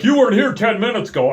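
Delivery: high, fast clipped accusation; accusing worker. Retold or imitated. Worker accusing the family, high fast accusation. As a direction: fire it off like a petty official.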